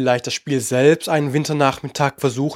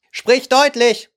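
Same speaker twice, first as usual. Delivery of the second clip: slow, higher, blunt eagle; 9 semitones higher, slower than usual. A narrator's reading. Eagle telling the Dodo to speak plainly, slow and blunt. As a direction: spell it out slowly, mocking the long words.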